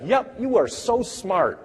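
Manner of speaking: put-on high, slow, sing-song praise for a small child; sweet praise. Teacher praising a six-year-old, high and slow sing-song. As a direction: gush over the kid like a proud teacher.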